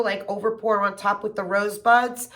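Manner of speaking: ordinary delivery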